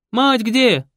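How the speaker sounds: loud, high yell. Acted. Raisa yells to the girl, loud and high. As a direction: bawl the question. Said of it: "завопила дама".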